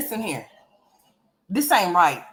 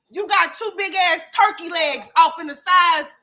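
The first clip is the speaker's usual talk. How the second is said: ordinary talk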